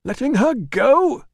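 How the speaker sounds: loud, high, slow outraged question; demanding. Acted. Manager demands an answer from the doorman, loud and high. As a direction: demand it in disbelief. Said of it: "demanded Priscus".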